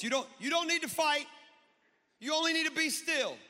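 high, loud preacher's declaration; emphatic proclamation. Real, not acted. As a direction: belt it out to the congregation. Preacher proclaiming scripture at full voice.

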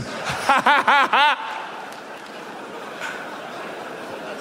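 long breathy laugh; real laughter; airy laugh. Real, not acted. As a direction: let the laugh run out. Laughing at own joke.

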